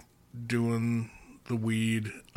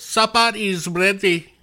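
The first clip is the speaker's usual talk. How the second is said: put-on loud, high Polish accent call; 10 semitones higher, 11 dB louder than usual. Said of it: "That's my Polish accent".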